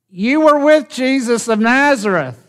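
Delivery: put-on high-pitched servant girl's accusation; accusing servant girl. Servant girl in the courtyard to Peter, accusing.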